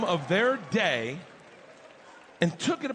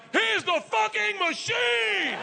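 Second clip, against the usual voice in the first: very high, slow, stretched-out yell; hyped shout; 4 dB louder, slower than usual. Retold or imitated. Bartender hypes the crowd with a slow, high shout. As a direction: bellow it like a ring announcer. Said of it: "the bartender replied".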